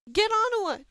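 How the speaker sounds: high, loud desperate order; screaming. A narrator's reading. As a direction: scream it up at the window. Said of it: "Dane screamed".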